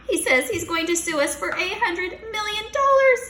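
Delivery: high, loud shout; teacher yelling in panic; frantic shout. Acted. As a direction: shout the lawsuit news. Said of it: "shouted Mister Klutz".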